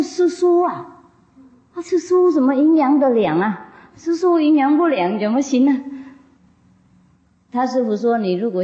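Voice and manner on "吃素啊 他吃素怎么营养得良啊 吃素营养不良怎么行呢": high indignant protest. Retold or imitated. Chieftain protests vegetarian diet. (首领惊讶抗议吃素)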